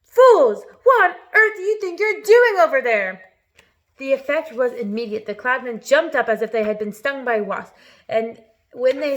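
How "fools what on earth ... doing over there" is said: high, very loud taunting yells; full-volume barrage of name-calling; yelled. A narrator's reading. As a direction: bellow each insult across a distance. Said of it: "loud as he could".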